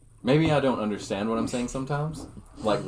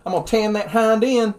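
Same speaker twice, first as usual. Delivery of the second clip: put-on Southern accent; 8 semitones higher, 4 dB louder than usual. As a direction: warn the boy like a Southern dad. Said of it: "the Southern accent".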